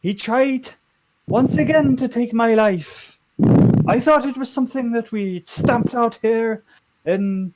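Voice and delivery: high, slow, loud Welsh oratory; solidly Welsh. Acted. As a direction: stretch the phrases out in a Welsh lilt. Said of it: "she's solidly Welsh now".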